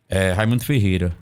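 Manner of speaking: usual speaking voice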